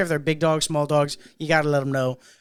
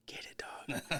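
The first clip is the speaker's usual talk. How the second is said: a breathless whisper egging him on; 17 dB quieter, much slower than usual. Retold or imitated. Manager slyly cheering on a call-out, whispered. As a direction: whisper it like a conspiring buddy.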